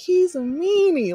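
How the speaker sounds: [name] imitation. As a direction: draw out the high meanie like Michael. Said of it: "I just hear Michael".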